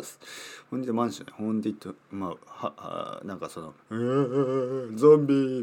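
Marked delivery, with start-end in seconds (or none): 3.9-5.6 zombie groan. Pretend. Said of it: "みたいな。".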